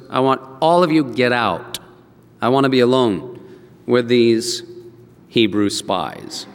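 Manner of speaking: low, measured command; stern order. Acted. Joseph sending all the Egyptians out, stern low order.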